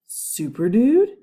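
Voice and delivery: loud, slow, rising disbelief; boy hears his hero named, shocked and loud. A narrator's reading. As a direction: gasp out the name in shock. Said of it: "Eugene was shocked".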